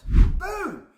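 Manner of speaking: triumphant shout; excited shout. Real, not acted. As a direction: punch it out like a mic drop. Excited tour pitch.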